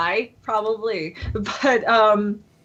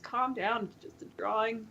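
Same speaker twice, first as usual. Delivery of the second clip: much softer, laughing under breath; quiet; 14 dB quieter than usual. Retold or imitated. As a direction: say it softly through a laugh. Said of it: "And I was just".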